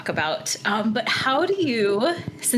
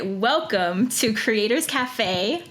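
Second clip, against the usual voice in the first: usual speaking voice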